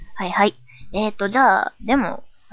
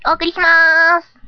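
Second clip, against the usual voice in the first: higher, louder, drawn-out announcement; cheerful echo; 6 semitones higher, 7 dB louder than usual. Acted. A persona echoing the show's opening, cheerful drawn-out call. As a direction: chime in brightly and stretch the ending. Second persona echoes the sign-off line.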